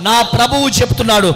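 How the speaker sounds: loud, building proclamation; preaching climax. Real, not acted. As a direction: swell to a loud promise. (గట్టిగా పెరుగుతున్న ప్రకటన)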